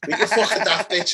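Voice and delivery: lightly mocked Yorkshire yell; loud, high put-on Yorkshire accent, laughing. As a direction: imitate a shouting Yorkshireman. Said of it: "doing the fucking Yorkshire accent again".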